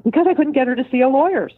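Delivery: a retold husband justifying himself, pitched high; exasperated client. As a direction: blurt it out like a cornered husband. Client's defensive answer retold.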